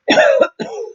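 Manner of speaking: forced demo cough, pitched high; fake cough. Shown as a demonstration. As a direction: push out a deliberate fake cough. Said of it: "watch what happens if I fake cough".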